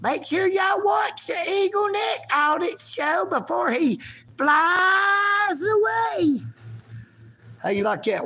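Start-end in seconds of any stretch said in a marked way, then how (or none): 0.0-6.4 cat apologizing. Acted. Owner makes the cat apologize to viewers, high pet voice. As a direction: make the cat say sorry in a high silly voice. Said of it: "You, you apologize".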